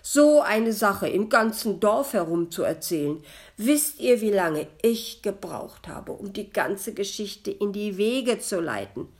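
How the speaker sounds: loud reproachful greeting; loud hurt reproach. A narrator's reading. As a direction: scold him for gossiping. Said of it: "begrüßte es den Metzger vorwurfsvoll".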